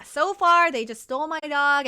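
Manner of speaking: ordinary talk, nothing marked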